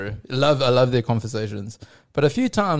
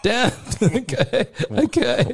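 laughing resignation; 7 semitones higher, slower than usual. Retold or imitated. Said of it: "And I was like".

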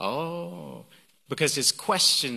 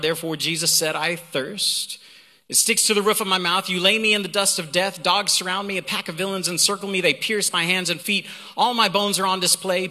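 raised, energetic reading voice; emphatic psalm reading; 3 semitones higher than usual. Acted. Preacher launches into the psalm after "Watch this", emphatic.

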